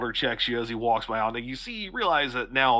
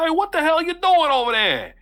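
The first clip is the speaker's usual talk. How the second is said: yelling across the street, high and loud; furious townsman yell; angry shout; 7 dB louder than usual. Acted. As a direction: bark it out at the stranger.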